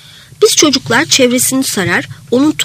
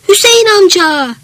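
calling out; 9 semitones higher, 4 dB louder than usual. Acted. Friend shouts up from the street below, calling out. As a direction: cry out to someone up at a window. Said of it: "diye bağırdı".

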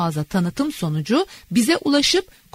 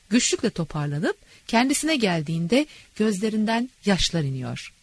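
ordinary delivery, nothing marked

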